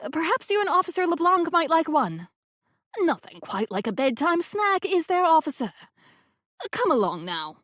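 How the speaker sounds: a hint of a gracious elderly hostess; genteel old woman welcoming the police; lightly voiced grand old lady. A narrator's reading. Rose leading officer away.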